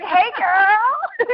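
put-on high-pitched, laughing girlfriend greeting; playful greeting. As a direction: call out high and playfully to a friend. Playful acted greeting between friends.

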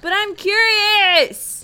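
drawn-out curious whine. Acted.

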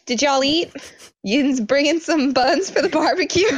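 high-pitched mock rural Pennsylvania accent. Teasing co-host about family's roots, high mock Pennsylvania accent. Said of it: "I only kid because my family is from there".

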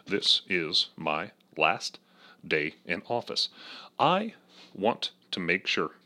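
measured presidential pauses; Obama impression. Comic doing a self-admitted bad Obama, low and slow. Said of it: "horrible Obama impression".

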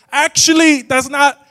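high, loud know-it-all interjection; high-pitched, insistent correction; loud correcting outburst. Acted. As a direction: burst in loudly to correct someone. Acted urge to correct people.

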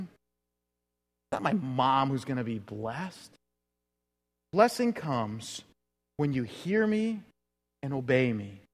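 soft, slow, steady reply; gentle correction. Retold or imitated. Jesus gently correcting the admiring woman, soft and slow. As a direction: calmly set her straight.

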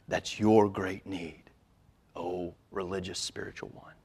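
soft, weighty words with long pauses; solemn pronouncement. Retold or imitated. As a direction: voice a grave verdict, dropping almost to a whisper. Preacher voices Jesus' solemn verdict.

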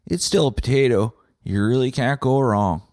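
ordinary delivery

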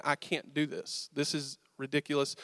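retold discouragement, kept quiet; defeated self-talk. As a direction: give up quietly, to yourself. Holding back tears, inner thought.

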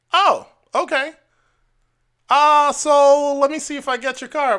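high squeaky astonished clerk; imitating a surprised clerk; mock surprise. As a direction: act shocked he's the man on the phone. Clerk voiced in story.

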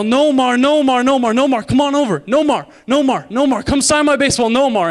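put-on high, loud kid calling out to a ballplayer; loud, high-pitched pleading shout; yelling. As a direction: yell it eagerly across the field. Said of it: "and I would yell".

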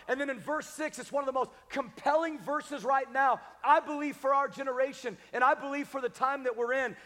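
ordinary talk

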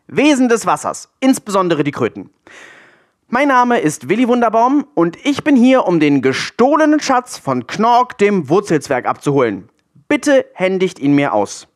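high, deliberate declaration, slowing on key words; loud, firm, resolute. A narrator's reading. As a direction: call out firmly across the water. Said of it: "mit derselben lauten, festen und entschlossenen Stimme".

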